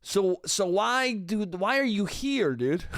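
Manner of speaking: normal talking voice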